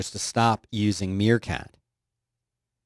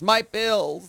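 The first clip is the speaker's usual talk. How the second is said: put-on high, quavering old-lady voice; 9 semitones higher than usual. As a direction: play a frail old lady searching. Old person voice bit.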